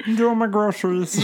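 high, chirpy shopper voice; victim voice. Pretend. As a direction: play an oblivious shopper. Voicing the victim woman.

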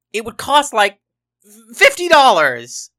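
loud, high-pitched protest; exasperated clerk. A narrator's reading. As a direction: build to an outraged high shout on the price. Exasperated clerk throws up his hands.

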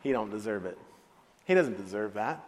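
low sulking grievance; understated, low-pitched anger; sullen anger. Acted. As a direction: grumble it low, nursing the grudge. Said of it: "The older brother became angry".

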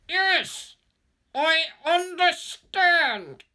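very high, slow strangled squeak; desperate, teeth grinding. A narrator's reading. Pinned man desperately agreeing to the threat, high strained squeal. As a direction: force it out through grinding teeth. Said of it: "I can hear my teeth grind together as I desperately try to say".

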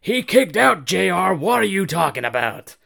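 imitated outraged commentator, loud and high; Don Callis. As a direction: yell it in protest like a rival announcer. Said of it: "Don Callis is like".

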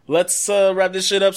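loud, high hype voice; hyped announcer. Acted. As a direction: pump up the wrap-up. Hyped sign-off.

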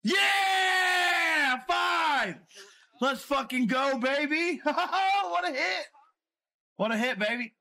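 high, loud whoops with a laugh; shouting and laughing over a big hit; ecstatic celebration. Real, not acted. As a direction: yell it like you just hit big. Celebrating a winning hand.

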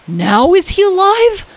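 high, loud, drawn-out question; impatient high character whine; pestering Lyle. Acted.

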